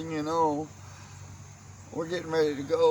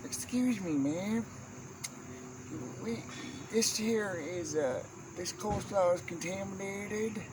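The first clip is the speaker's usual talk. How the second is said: put-on higher, fussy old-lady complaint; prim old aunt; 5 semitones higher, 5 dB quieter than usual. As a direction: play the aunt as a dainty little complainer.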